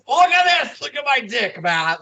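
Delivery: high, loud hammed-up outburst; mock wrestler yell. Retelling a wrestler's sudden turn on the host, mock yell. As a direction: burst out yelling as an imitated wrestler.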